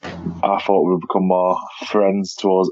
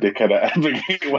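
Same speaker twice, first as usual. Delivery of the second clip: normal talking voice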